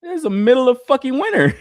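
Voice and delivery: high, bragging delight; boasting to a friend, high and excited; retold excitement. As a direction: brag about the new client. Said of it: "I was like".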